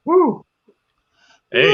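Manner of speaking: high whooping woo; excited cheer. Real, not acted. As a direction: cheer for the birthday. Cheer for birthday.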